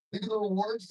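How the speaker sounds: ordinary talk, nothing marked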